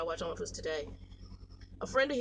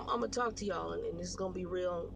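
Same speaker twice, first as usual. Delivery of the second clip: ordinary talk, nothing marked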